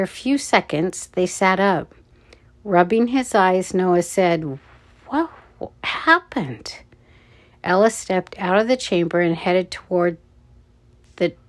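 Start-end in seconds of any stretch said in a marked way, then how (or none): 5.1-6.8 higher, halting startled question; dazed stammer. A narrator's reading. Noah rubs his eyes after the flash, dazed stammer.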